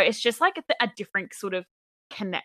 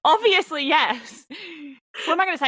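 the answer's too obvious to ask; asked if she misses home, high emphatic yes; 9 semitones higher than usual. Real, not acted.

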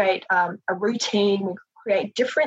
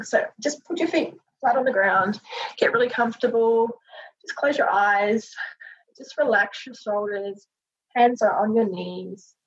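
soothing massage murmur. Acted. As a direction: ease them gently into breathing. Leads a guided breathing meditation.

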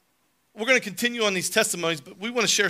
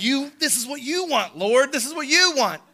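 desperate plea; 9 semitones higher, 5 dB louder than usual. Retold or imitated. Desperate prayer pleading with God.

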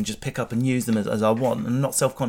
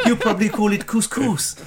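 high, laughing mock-posh condescension; patronising posh host; 8 semitones higher than usual. Posh host correcting the peasant, mock patronising. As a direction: put on a high superior posh voice.

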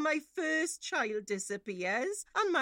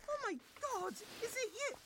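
much higher, hushed and breathless disbelief; shocked gasp; 12 semitones higher, 8 dB quieter, faster than usual. Acted. Mother stumbles on her daughter's girlfriend at home, hushed and shocked. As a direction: gasp it out, stunned to see her.

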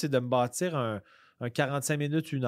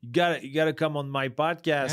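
imitating pushy texter; 3 semitones higher, 5 dB louder than usual. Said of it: "de la façon que tu m'as texté".

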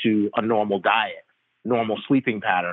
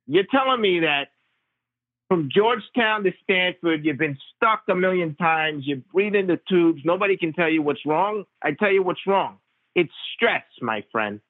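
pitched-up imitation of a blunt, know-it-all Long Island Italian doctor; 7 semitones higher than usual. Primary care doc scolding the patient about his cough, a higher, blunt imitation. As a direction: play the old family doctor, much higher, scoffing and certain. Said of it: "typical L-, Long Island Italian doctor".

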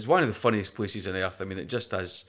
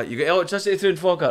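mocking Glaswegian jibe; 6 semitones higher, 4 dB louder, faster than usual.

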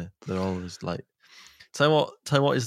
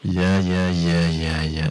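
voice effect; 2 semitones lower, 8 dB louder, much slower than usual. Shown as a demonstration. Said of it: "Do you think that's okay?".

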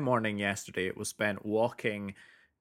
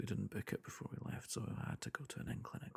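hushed, sheepish whisper; 11 dB quieter than usual. Real, not acted. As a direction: whisper it like a guilty confession. Sheepishly confessing own disorganization.

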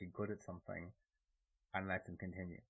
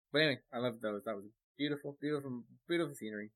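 excited; 4 semitones higher, 7 dB louder, faster than usual. Real, not acted. Gushing about the scenery.

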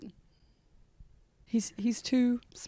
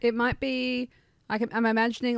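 ordinary delivery

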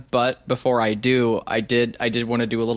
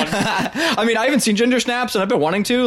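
high, laughing protest; excited protest; 10 semitones higher than usual. Real, not acted. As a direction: push back with a laugh, voice up. Defensive over a favorite film.

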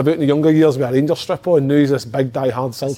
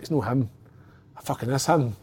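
muttered aside; 7 dB quieter than usual. Retold or imitated. Retold argument with himself.

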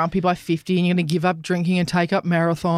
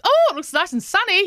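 mock cheerful quote; 11 semitones higher than usual. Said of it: "I was like".

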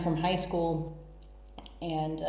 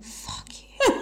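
squealed through laughter; giggling mock insult; laughing; 5 dB louder than usual. Real, not acted. Joking jab at listeners.

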